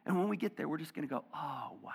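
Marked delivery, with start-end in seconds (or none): 1.3-1.9 softer, hushed wonder; hushed awe at what God did; awed whisper. Acted. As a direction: whisper it as if kneeling. Awe before God, bowing lives.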